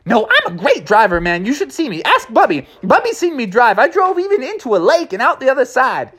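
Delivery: put-on high, boastful kid voice; excited young bear bragging; eager young bear. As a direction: brag like a cocky cub. Junior bragging in story voice.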